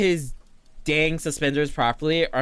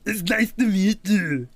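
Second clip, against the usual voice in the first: put-on slow, higher Kermit voice straining at an Arnold accent; Kermit doing Arnold; 4 semitones higher than usual. Streamer demos Kermit imitating Arnold, slow and higher. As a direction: play Kermit trying to sound like Arnold Schwarzenegger. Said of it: "Kermit is trying to sound like Arnold Schwarzenegger".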